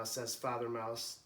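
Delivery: ordinary delivery, nothing marked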